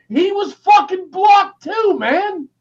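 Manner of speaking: shouting about a blocked friend; angry shout. Real, not acted. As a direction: hammer it out in frustration. Ranting about blocked viewers.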